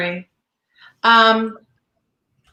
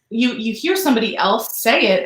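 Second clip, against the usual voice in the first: usual speaking voice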